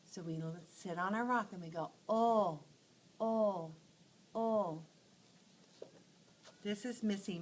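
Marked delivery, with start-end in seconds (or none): 2.1-4.8 sighing oh. Acted. Kids sitting on rock, sighing.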